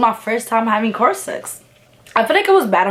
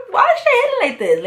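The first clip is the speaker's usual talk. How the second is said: fast and much higher, astonished; shocked drunk surprise; faster than usual. Retold or imitated. Recalling the drink hitting her, fast high disbelief. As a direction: squeak it out in disbelief. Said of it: "I'm like".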